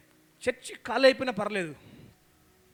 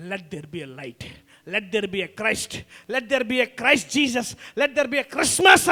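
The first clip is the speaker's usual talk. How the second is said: God's creating command; 6 dB louder, much slower than usual. Acted. Preacher voicing God's command to the church, slow and swelling. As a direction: declare it slowly, swelling with each line. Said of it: "అన్నాడంట ఆ రోజు".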